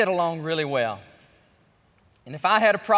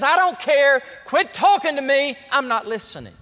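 louder, sulky shout; mock shout; 5 semitones higher, 5 dB louder than usual. Jacob to his sons, a sulky shout. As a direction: shut the sons down with a yell.

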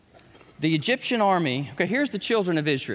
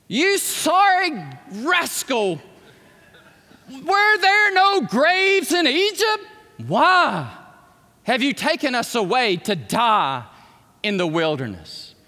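slow, loud, higher accusing yell at Moses; mock outrage, drawn out and rising; angry crowd; 5 semitones higher, 4 dB louder, slower than usual. Israelites berating Moses at the sea, a slow hammed-up yell. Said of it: "this is what they said to Moses".

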